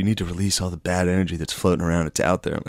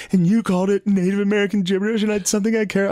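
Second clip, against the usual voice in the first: much higher-pitched mock complaint; offended girlfriend; 12 semitones higher, faster than usual. Girlfriend upset he called it gibberish, reproachfully. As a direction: imitate a hurt partner's accusation.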